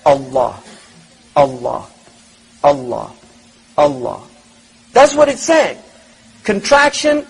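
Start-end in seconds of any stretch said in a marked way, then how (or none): 0.0-4.2 heartbeat Allah. Shown as a demonstration. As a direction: chant "Allah" deep and even like a heartbeat. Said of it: "It says".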